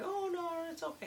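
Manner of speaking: imitating Amber, high and light; high mock Amber voice. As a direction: pitch way up as the sweet coworker. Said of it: "And she was like".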